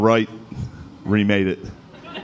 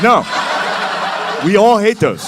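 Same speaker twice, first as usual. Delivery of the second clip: exasperated friends; 7 semitones higher than usual. Retold or imitated. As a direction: beg them to stop, half laughing.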